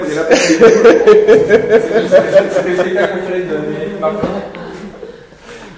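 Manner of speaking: helpless long laughter; long loud laugh. Real, not acted.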